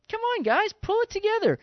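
high-pitched, pleading pep talk; rally the frightened disciples, voice up high; urgent encouragement. Retold or imitated. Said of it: "He's kinda going".

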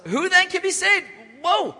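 high, loud cry of disbelief; greatly astonished. Acted. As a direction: act out stunned disciples, voice shooting up. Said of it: "They're greatly astonished".

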